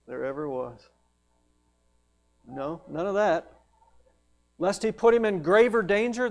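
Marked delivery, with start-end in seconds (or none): 0.0-0.9 low-key, musing wonder; understated awed murmur; quiet self-amazement. Acted. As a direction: softly marvel at yourself. John imagined marvelling at himself.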